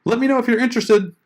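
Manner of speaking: high, overly chirpy mock sign-off; mock bad pitch. Shown as a demonstration. Blogger parodying a bad pitch ending, high and chirpy. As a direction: parody a vague, eager email ending. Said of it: "That's pretty bad".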